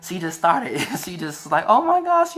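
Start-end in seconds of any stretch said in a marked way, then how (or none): 1.7-2.4 much higher, louder sweet gasp, imitating her; real sweet. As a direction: coo it in her sweet, pitched-up voice. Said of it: "real sweet".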